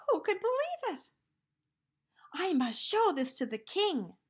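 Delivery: high, loud amazed cry; excited. Acted. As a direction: burst with excitement.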